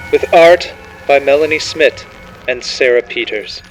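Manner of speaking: ordinary delivery, nothing marked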